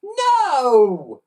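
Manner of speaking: high, loud, stretched No; a friend's high loud cry of alarm. Acted. Boy falls into the moat.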